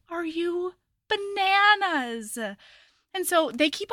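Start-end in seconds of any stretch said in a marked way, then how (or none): 0.1-2.4 high, drawn-out mock disbelief; slow, exaggerated appalled question; retold incredulity. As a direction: stretch it out high, utterly incredulous. Retold incredulous reaction to him.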